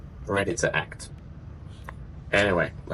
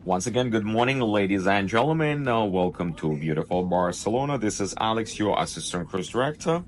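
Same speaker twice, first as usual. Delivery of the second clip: upbeat cruise director; 4 semitones higher than usual. Acted. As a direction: welcome the guests over the ship speakers. Ship PA announcement read.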